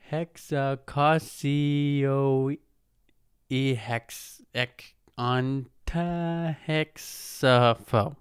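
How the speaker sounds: very slow, syllable by syllable, stumbling through Hexacosiohexagonta-hexapho; reading slowly. Shown as a demonstration. Reading the long phobia name to viewers, slow and stumbling. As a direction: sound out the long word slowly, with a laugh. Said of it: "I'm gonna read this one slow".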